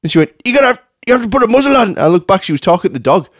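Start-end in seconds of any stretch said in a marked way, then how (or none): 0.4-1.9 fast, high mock scolding; hammed-up high, rapid telling-off; going ballistic. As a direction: scold the dog as its frantic owner. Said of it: "she was going ballistic with him".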